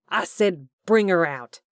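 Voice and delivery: loud, furious shouted order. A narrator's reading. Hugh shouting at Corbin to bring her out, furious. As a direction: bark the demand.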